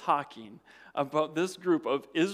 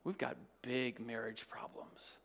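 hushed; 4 semitones lower, 8 dB quieter than usual. Retold or imitated. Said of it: "You may say".

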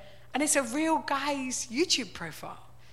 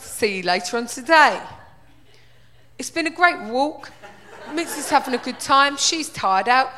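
loud, cheery middle-aged bloke presenting his walk; hammed-up dog vlogger; 9 dB louder than usual. Impersonating the fifties dog-walker narrating his walk in a loud, cheery voice. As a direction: ham up a chatty dog-walking vlog.

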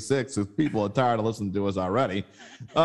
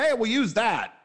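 pitched up, eager pitch; a hammed-up eager staffer; mock eager; 9 semitones higher than usual. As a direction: sell it like a proud intern. Baby Cake staff quoted.

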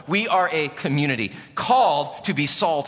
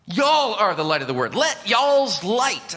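Southern translation; 4 semitones higher than usual. Shown as a demonstration. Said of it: "translate this Southern".

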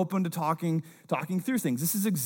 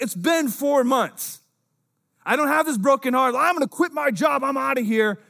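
mock rash quitting; 9 semitones higher, 5 dB louder than usual. Nehemiah imagined quitting rashly.

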